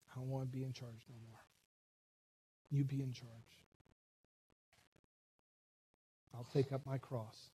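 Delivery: soft, low words of prayer; quiet surrender. Acted. Models a prayer of surrender to Christ.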